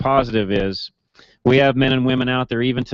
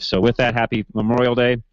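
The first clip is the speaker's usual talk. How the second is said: ordinary delivery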